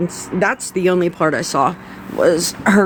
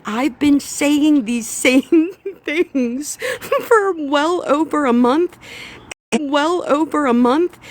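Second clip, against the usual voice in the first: higher, slow, laughing in disbelief; incredulous rant pitched up; pitched-up disbelief at being ignored for a month; exasperated; 9 semitones higher than usual. Real, not acted. Exasperated complaint about being ignored.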